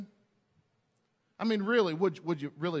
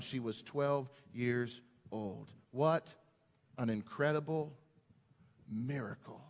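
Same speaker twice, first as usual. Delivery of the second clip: awed hush; 8 semitones lower, 6 dB quieter than usual. Real, not acted. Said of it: "awed close to the miracle story".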